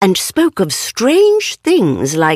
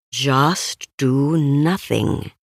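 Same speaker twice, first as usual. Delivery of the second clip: slow, softer calm advice; calm reminder; 4 semitones lower, 4 dB quieter, much slower than usual. Acted. Christopher Robin calming himself in the filling trap, slow and soft.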